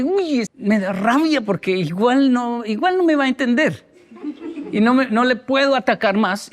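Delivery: father arguing with his wife, a mock frustrated plea; frustrated parent. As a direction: vent like a parent who gives up arguing. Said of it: "la discusión llega a un punto donde dice".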